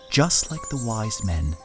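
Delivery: ordinary delivery, nothing marked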